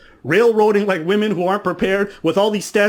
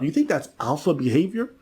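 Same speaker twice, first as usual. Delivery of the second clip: ordinary talk, nothing marked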